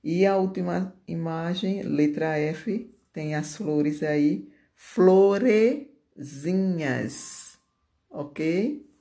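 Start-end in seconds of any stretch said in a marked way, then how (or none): 4.7-7.6 "Florezinhas" very drawn out, split before the ending; stretched "Florezinhas" with a pause inside; slow dictation. Shown as a demonstration. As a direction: dictate "Florezinhas" in two long parts. Teacher dictates the diminutive in parts.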